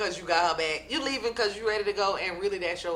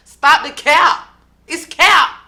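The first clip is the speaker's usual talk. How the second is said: loud exasperated call; 8 semitones higher, 15 dB louder, slower than usual. Retold or imitated. Jackie's angry climax, voiced.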